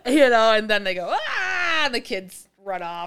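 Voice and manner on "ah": high, drawn-out pretend scream; mock scream. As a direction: scream like fleeing kids, hammed up. Kids scream and run off.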